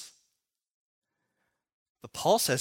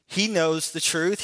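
ordinary delivery

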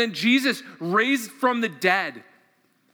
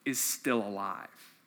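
tender closing; 8 semitones lower, 7 dB quieter than usual. Real, not acted. Closing reflection on the resurrection.

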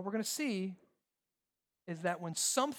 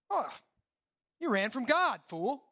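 mock moralistic scolding; 5 semitones higher than usual. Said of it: "moralistic people like to do".

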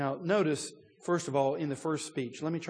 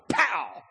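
mock pop; 8 semitones higher, 7 dB louder than usual. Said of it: "The cork is ready to come off".

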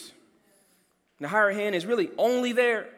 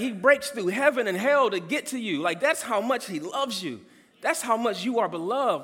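normal talking voice

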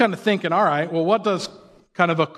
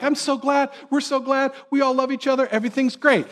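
much higher, bubbly mock cheer; hammed-up saccharine high voice of a fake-friendly churchgoer; fake happy hypocrite; 8 semitones higher than usual. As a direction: gush like a fake-smiling greeter. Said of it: "they're like".

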